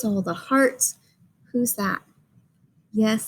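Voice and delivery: usual speaking voice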